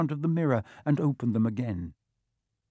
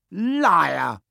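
sudden loud, higher outburst; shouted; 5 semitones higher, 6 dB louder than usual. A narrator's reading. Harry accuses the face of lying, shouting. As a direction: burst out with a shout. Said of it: "Harry shouted suddenly".

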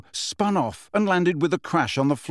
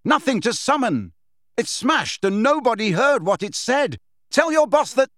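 high-pitched shout, building louder; defiant shout; 9 semitones higher, 3 dB louder than usual. A narrator's reading. Harry goading witch about her boss, shouting. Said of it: "he shouted".

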